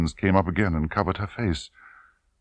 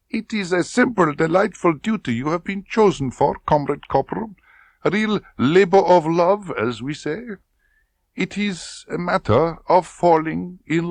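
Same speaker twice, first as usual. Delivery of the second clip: insinuating colonel; 13 semitones higher than usual. Acted. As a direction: lean in on the question. Klebb explains.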